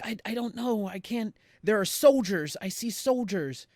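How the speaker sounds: high, urgent, stammered alarm; mock panic. Son telling mother what he sees, acted panic.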